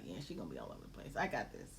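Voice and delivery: low, fast, easy husband voice. Retold or imitated. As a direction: play the calm husband, quick and low. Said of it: "He was like".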